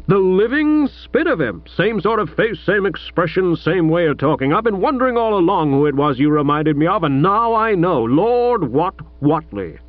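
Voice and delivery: lightly voiced gruff American father; a hint of an overbearing American millionaire; American tycoon father.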